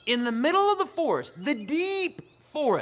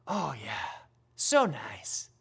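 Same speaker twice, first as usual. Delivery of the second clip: ordinary talk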